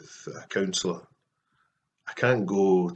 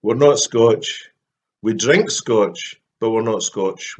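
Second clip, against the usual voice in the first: louder and slow, leaning on not; mock-stern correction, punching not; emphatic correction; 2 semitones higher, 8 dB louder, slower than usual. Real, not acted. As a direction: set the record straight firmly. Correcting Scotch to Scots.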